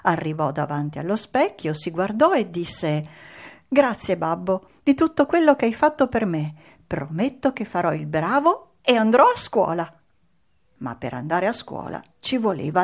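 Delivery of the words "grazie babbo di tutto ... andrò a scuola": slightly higher, eager promise; a touch of grateful little puppet; lightly voiced puppet child. A narrator's reading. Pinocchio thanking his father, lightly voiced eager promise.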